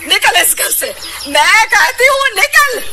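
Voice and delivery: high, rising furious command; angry order. Acted. Mother-in-law angrily orders Ritu out of the house. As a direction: throw her out of the house.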